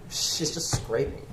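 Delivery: hushed, unvoiced aside to his partner; whispered aside. Thug whispering to Raymond about the waitress. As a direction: keep it under your breath so she can't hear.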